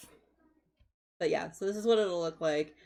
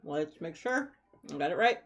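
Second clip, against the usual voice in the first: ordinary delivery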